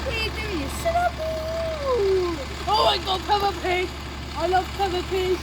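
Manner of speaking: high squeaky voice. Real, not acted. Friend gushing high-pitched.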